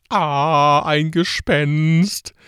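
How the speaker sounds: high, loud, drawn-out scream of fright; hammed-up wail, stretching the last word; mock panic. Preacher mimics the disciples panicking at Jesus on water, mock panic. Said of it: "die werden panisch".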